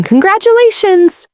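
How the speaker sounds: high, bright congratulations; excited cheer. A narrator's reading. Guests cheering the bride, excited and high. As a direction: call it out with delight.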